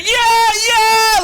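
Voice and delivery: high, loud cheering whoop. Acted. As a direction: cheer it like a crowd.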